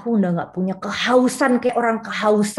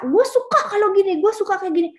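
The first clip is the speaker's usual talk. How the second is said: eager voice; 9 semitones higher than usual. Retold or imitated. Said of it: "bisa membuat dia rasa".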